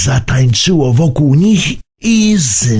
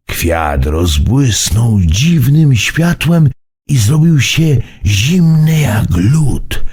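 plain reading voice

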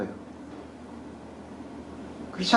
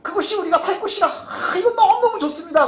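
much higher, eager acted voice; enthusiastic report; 12 semitones higher than usual. Two scouts reporting on the promised land, enthusiastic. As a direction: sell it with high-pitched enthusiasm.

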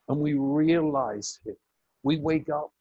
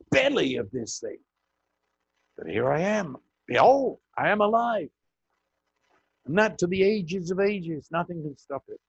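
risen Jesus proclaiming; 5 semitones higher, 3 dB louder than usual. Acted. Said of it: "he said".

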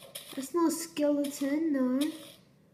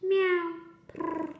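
cat voice; 6 semitones higher than usual. Pretend. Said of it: "I'm a cat".

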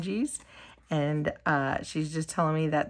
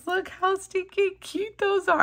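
excited; 11 semitones higher than usual. Real, not acted. Cooing over cow dies.

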